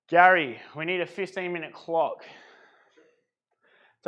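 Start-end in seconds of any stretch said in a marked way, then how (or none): 0.1-0.6 loud call across the room. Real, not acted. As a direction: call his name across the gym. Calling out across the gym.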